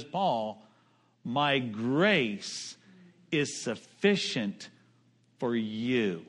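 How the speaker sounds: hushed, slow gentle answer; tender divine reassurance. Retold or imitated. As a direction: comfort him with an arm round his shoulder. God's arm around Paul.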